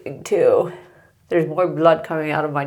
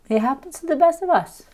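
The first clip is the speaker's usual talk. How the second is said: high playful; 10 semitones higher, faster than usual. Real, not acted. Light self-reassurance.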